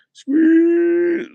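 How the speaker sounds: a squeaky, loud squish noise; high squelch. Acted. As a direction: make the splat sound, high and loud. Acted squish sound effect.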